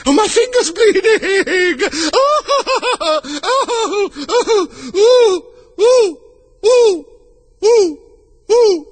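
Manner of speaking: very high, loud shrieks of oh; screaming. Acted. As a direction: shriek like a steam whistle. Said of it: "the Queen began screaming so loud".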